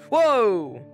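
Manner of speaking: startled exclamation; sudden loud surprise; surprised whoa. Real, not acted. Surprised by game.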